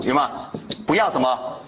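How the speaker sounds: ordinary delivery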